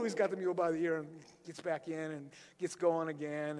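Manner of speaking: usual speaking voice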